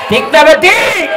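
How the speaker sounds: high, loud rallying shout. Real, not acted. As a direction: shout it out to rouse the crowd. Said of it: "জোরে কও". (জোরে হাঁক দিয়ে জনতাকে সাড়া দিতে বলা)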